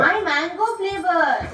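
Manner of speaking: high, slow sing-song coo. Real, not acted. Mother coos to her daughter, slow high baby talk.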